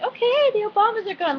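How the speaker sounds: high-pitched mock announcement; imitated eager call to move on; acted quote. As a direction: voice the characters way up high. Acting out Sam and Luna's reaction.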